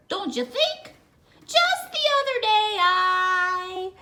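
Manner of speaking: high fast chattering dog voice; high chattering friendly dog; chatty dog. Acted. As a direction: babble on high and quick.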